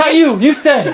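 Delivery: insistent wife. Acted. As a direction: stop him firmly.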